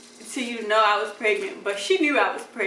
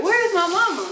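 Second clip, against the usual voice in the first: anxious call; 8 semitones higher, 4 dB louder than usual. Retold or imitated. Mom missing during labor.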